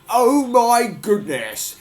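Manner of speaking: slow, high, loud astonished cry; excited cry. Acted. Farmer running out to see the egg, astonished cry. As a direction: cry out in amazement, stretching it. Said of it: "he cried".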